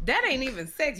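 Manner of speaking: ordinary delivery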